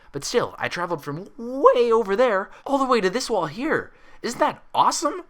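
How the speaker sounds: high, bouncy and loud, rattling on; bubbly Pinkie excitement. A narrator's reading. Pinkie boasting to friends about sliding down the hallway, bubbly. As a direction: gush like a kid who just had the best idea.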